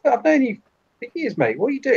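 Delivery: very high, panicky protest; alarmed appeal. Acted. Lead figure appeals to Nigel, alarmed and high-pitched. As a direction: plead with an old friend turned threatening. Victim pleads with approaching Nigel.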